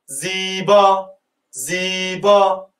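teacher models زیبا slowly for the class, drawn out; drawn-out model word. Shown as a demonstration. Spell out زیبا syllable by syllable, slow. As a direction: stretch زیبا slowly, long final ا. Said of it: "بگو".

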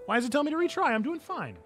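high-pitched whine at the game; indignant protest. Real, not acted. Player objects to retry prompt, whining.

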